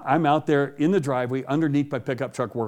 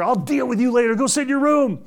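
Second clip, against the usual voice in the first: stern dad; 9 semitones higher, 4 dB louder than usual. Retold or imitated.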